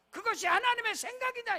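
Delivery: 하나님의 driven loud and high, then falling; shouted proclamation. Acted. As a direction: hit 하나님의 with full force. Repeats key word. (하나님의를 크게 외치며)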